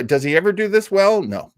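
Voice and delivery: ordinary talk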